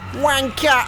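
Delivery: a quick cheeky shout of the word, pitched high; yelled insult. Pretend. Streamer taunting a game enemy, quick cheeky yell. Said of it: "I'm gonna call him a wanker".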